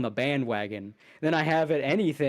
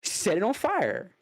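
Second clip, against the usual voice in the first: Beavis mode; 5 semitones higher than usual. Pretend. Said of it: "I'm going Beavis mode".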